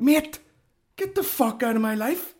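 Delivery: high-pitched mock exasperation; exasperated. Comic fed up with a fan's questions, exasperated. Acted reply to nosy fan.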